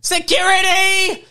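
loud high shout; shouting for security. Pretend. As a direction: yell across the shop for help. Calling security in the shop.

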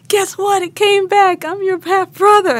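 very high, loud, bursting with news; imitating excited announcement. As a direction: belt out big news as the thrilled brother. Brother's excited news acted out.

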